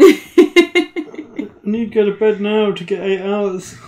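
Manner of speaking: high, loud, drawn-out whine; hammed-up bedtime complaint, slow and pleading; mock whining. Tired commentator needing eight hours, mock whining.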